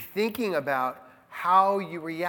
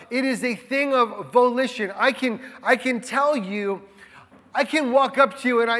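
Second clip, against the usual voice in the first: ordinary delivery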